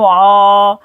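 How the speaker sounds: आ as a long held throat vowel; lengthened, steady आ; stretched aa. Shown as a demonstration. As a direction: draw out the आ on a level note. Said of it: "खींच कर पढ़ेंगे".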